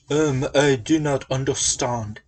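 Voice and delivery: put-on French accent. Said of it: "he's like".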